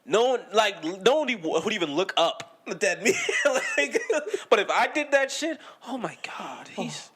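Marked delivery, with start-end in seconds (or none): 5.9-7.1 softer, a breathy hushed aside; mock whisper. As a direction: whisper it like scandalized gossip. Mock hushed gossip about him.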